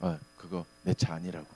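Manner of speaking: low, hushed protest. Retold or imitated. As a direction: say it under your breath, holding back. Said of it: "흉내만 했습니다". (낮고 작게 속으로 삭이듯)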